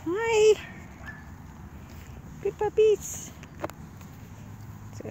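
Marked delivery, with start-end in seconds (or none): 0.1-3.3 puppy talk. Real, not acted. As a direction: coo at the puppies up high. Greeting puppies, high-pitched.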